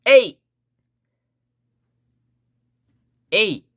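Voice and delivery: high, loud short call; punchy battle cry. Shown as a demonstration. Teacher leading learners to say it, loud battle cry. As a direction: shout it for learners to copy.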